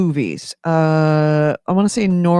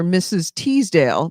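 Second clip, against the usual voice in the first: ordinary talk, nothing marked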